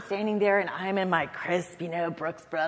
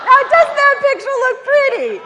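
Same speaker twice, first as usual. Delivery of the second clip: much higher, loud, laughing pride; delighted self-praise; 15 dB louder than usual. Retold or imitated. Young staffer pleased with her microphone bouquet, laughing proudly. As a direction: admire your own handiwork. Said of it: "And I thought".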